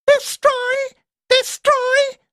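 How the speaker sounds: put-on very high, gleeful childlike trill. A narrator's reading. Quarks about to fire, high gleeful trill. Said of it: "they trilled".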